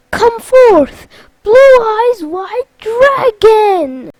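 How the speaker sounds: very loud, high summoning shout; battle summons. Acted. Sora cutting off Zexion, bellowed summons. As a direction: bellow it as a grand interruption.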